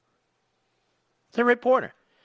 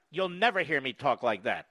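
ordinary delivery

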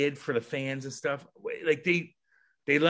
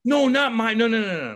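high, loud rapid denial; emphatic protest; 9 semitones higher, 6 dB louder than usual. Real, not acted. Guest denies claiming the room, emphatic protest. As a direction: jump in to correct him. Rejects the cocky reading.

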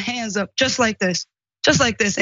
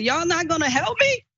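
higher, pleading call to bystanders; desperate plea, pitched up; retold scream for help; 8 semitones higher than usual. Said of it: "that's what I was screaming".

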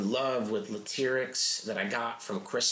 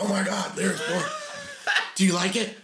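higher, louder laughing excitement; giddy laughing fan; 8 semitones higher, 3 dB louder than usual. Retold or imitated. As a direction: gush like a starstruck fan. Star calls him at 2 AM.

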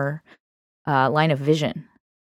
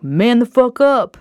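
higher, louder, stretched-out command; tough parent's order; 7 semitones higher, 6 dB louder, slower than usual. Acted. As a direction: scold a child loudly and slowly. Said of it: "and they're like".

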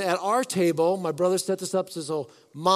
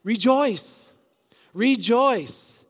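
rejoice; 5 semitones higher, 4 dB louder, much slower than usual. Shown as a demonstration. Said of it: "after each verse comes what".

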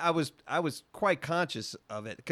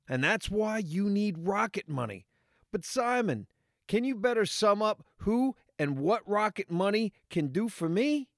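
bright scripted sponsor voice; ad read; 5 semitones higher than usual. Acted. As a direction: read it like a cheesy ad. Ad read hand-off.

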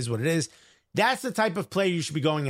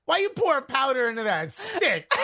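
high, laughing confusion; puzzled by a gun you've never seen; mock baffled; 8 semitones higher than usual. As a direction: ancient soldier puzzled by a musket, laughing. Roman baffled by gunpowder.

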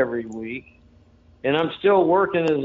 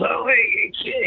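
put-on jumpy, high-pitched "hey, hey" of a startled old man; startled greeting; 9 semitones higher than usual. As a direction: play the caught-off-guard old wrestler jumping up. Startled gatekeeper greets him.

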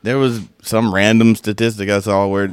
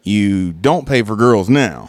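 slow and deliberate; 2 semitones lower, slower than usual. Real, not acted. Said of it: "slow explanation".

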